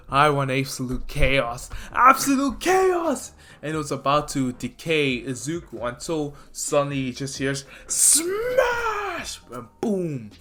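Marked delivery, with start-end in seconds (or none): 0.1-3.3 villain shout. Retold or imitated. Shigaraki declaring his goal to Izuku, rising to a shout. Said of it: "Shigaraki's like". 7.9-9.4 long, drawn-out, very high cry of smash; shouted smash. Retold or imitated. Mirio's attack announced with a drawn-out cry. Retold sound of Mirio's punch.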